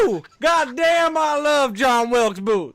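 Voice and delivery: put-on whooping drunk Southern guy, loud and high; southern drunk accent. Card reader hams a drunk Southern guy: loud Southern holler. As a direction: holler it like a drunk Southern good ol' boy. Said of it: "drunk fucking Southern guy".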